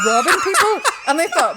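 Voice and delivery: squealing with laughter mid-sentence; laughing. Real, not acted. Telling friends about the secret camera, laughing. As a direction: laugh through the words, high and loud. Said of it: "S1 laughs".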